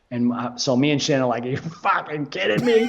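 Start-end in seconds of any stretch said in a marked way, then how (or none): 1.4-2.8 excited disbelief. Retold or imitated. Teen fans told to come onstage.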